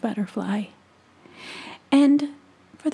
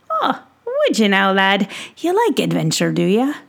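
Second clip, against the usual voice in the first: louder, hearty merchant voice; jolly pixie peddler; 6 dB louder than usual. Acted. Peddler teasing young Tom about salt, hearty and louder. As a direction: sell it like a showman at his cart.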